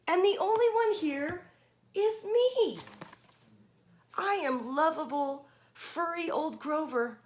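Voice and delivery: high, loud furry monster voice; Grover voice. Acted. Grover telling the kids he is the monster, high. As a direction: squeal it out as lovable Grover.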